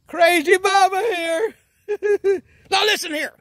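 giddy cracking intro. Acted.

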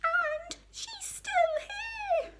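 slow, extremely high cub whine; hammed-up baby bear wail; crying Baby Bear. A narrator's reading. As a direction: drag it out in a tiny tearful squeak. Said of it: "cried Baby Bear".

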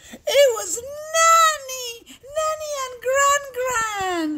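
high, delighted announcement; thrilled, drawn-out storyteller's reveal; excited reveal. Acted. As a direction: announce the visitors with high-pitched glee. Revealing the visitors.

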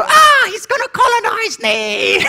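high, hammed-up panic; mock fright. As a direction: squeal it in fake fear. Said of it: "And then go".

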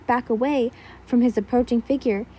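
usual speaking voice

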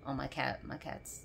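quiet cat aside; hushed aside. Real, not acted. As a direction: drop the voice for a quick aside.

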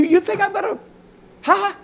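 mock-incredulous rising cry; incredulous scoff. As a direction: scoff at the very thought. Scoffing at the idea.

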